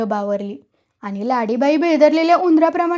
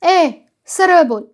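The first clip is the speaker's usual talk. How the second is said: louder, drawn-out irritated order; irritated snap; 3 semitones higher, 6 dB louder, slower than usual. A narrator's reading. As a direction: cut him off, annoyed and loud. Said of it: "संपत्ती चिडला".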